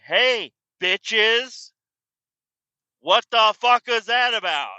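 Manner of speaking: high loud furious greeting; angry yell. Retold or imitated. Confronting coworkers at the rail yard, furious yell. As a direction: yell it at them as you walk up.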